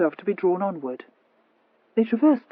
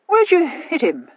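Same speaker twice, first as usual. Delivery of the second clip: higher, slow insistent question; demanding; 11 semitones higher, 7 dB louder, slower than usual. Acted. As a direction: demand an answer from your sister. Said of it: "demanded the Viscount".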